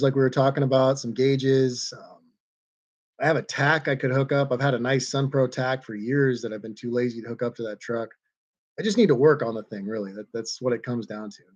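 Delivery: ordinary delivery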